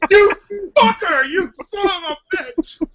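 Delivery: high-pitched wailing curse; hammed-up sobbing fury; tearful mock rage. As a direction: curse through pretend tears of anger. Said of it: "crying tears of anger".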